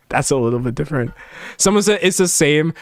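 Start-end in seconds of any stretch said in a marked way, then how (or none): 0.1-1.1 laughing aside. Shown as a demonstration. To chat, a quick laughing muttered correction. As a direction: chuckle it under your breath, quickly. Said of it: "repeat, laughing and quicker".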